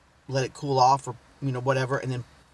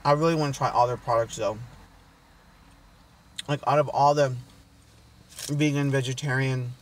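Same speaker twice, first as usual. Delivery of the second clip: talking while chewing; speaking between bites; mouth partly full. Real, not acted. Seen 1: Bites at -3 to -1 and +1.6; talks holding food, likely chewing.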